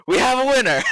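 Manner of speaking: loud, high proclamation; announcing a winner. Acted. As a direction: call it out like a contest judge. Barmaid declares drinking contest winner.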